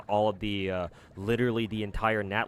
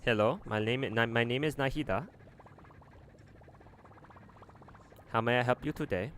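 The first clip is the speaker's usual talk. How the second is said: a badly faked Indian accent, slightly higher; polite call centre greeting in a weak Indian accent; 3 semitones higher than usual. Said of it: "my Indian accent is very terrible".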